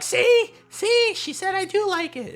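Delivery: very high, loud mock-mom falsetto; imitated triumphant squeal, starting loud; imitating vindicated mom. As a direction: crow it like a mother proved right. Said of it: "my mom's like".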